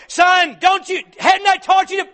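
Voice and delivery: loud, high nagging scold; scolding parent. Retold or imitated. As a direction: scold the son sharply. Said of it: "When your children don't put their shoes away".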